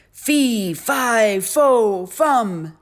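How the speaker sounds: loud booming chant, pitch stepping down; giant's shout. A narrator's reading. Giant suddenly appearing before Jack, booming shout. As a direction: bellow each word separately like a giant.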